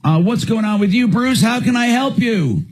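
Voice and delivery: upbeat, high radio host voice; energetic call-in show host. Acted.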